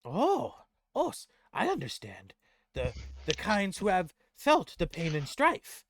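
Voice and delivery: high, loud, earnest goblin voice; understanding goblin. Acted. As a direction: agree earnestly as a little goblin.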